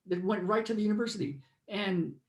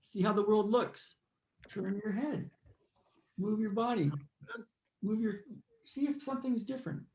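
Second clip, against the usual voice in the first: guided meditation. Acted. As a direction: lead them inward, unhurried.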